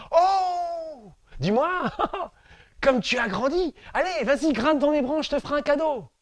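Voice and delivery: light up at seeing him grown; tree overjoyed at the returning youth, high voice; delighted tree. Acted.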